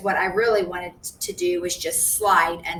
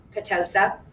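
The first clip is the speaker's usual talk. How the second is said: ordinary delivery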